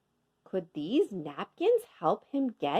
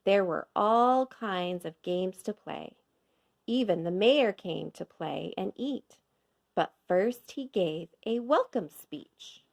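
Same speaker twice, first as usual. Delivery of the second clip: ordinary delivery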